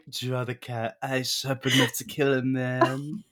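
mock dramatic. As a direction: stretch it out as a hammed-up imitation. Mocking imitation of the eyeliner line.

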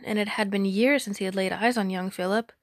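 plain reading voice

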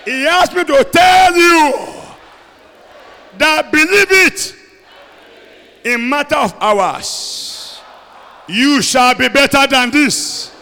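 shouted prayer, stretched and loud; preaching at full force. Acted. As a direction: pray it out at full force over the crowd.

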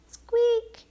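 tiny squeaky mouse cry; high mouse squeak. Acted.

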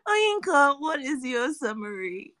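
normal talking voice

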